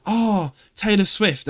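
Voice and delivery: slow, drawn-out fangirl gush; gushing fan. Retold or imitated. Mimicking Beth posting about Taylor, slow gushing. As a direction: swoon over the name. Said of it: "Beth would put on a story".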